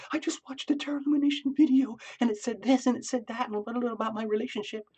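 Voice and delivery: high, breathless, gushing chatter; gushing excitement. Retold or imitated. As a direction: squeal it out like an overexcited friend spilling news. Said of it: "that you don't have to talk about, like".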